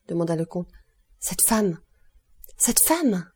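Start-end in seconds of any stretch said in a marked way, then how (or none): 1.2-1.8 hushed, mild shock; shocked recognition. A narrator's reading. Steward spots a woman among the guests, shocked recognition. 2.6-3.3 higher, falling cry of recognition; agitated shock. A narrator's reading. Steward points out the woman to the count, agitated shock. Servant repeats it, more agitated.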